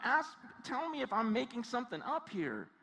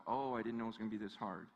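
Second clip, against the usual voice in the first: mild regret; 13 semitones lower, 7 dB quieter, faster than usual. Acted. Imagined quitter regretting halfway.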